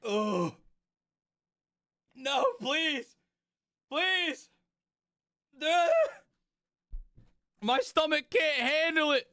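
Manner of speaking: pained pleading with a sour mouthful; eating sour candy. Real, not acted. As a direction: whimper through the sour taste.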